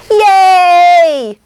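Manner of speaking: loud, high, long-held yay; baby's happy squeal. Retold or imitated. Imitating her baby niece's greeting, loud high happy squeal. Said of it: "she goes like this, she goes".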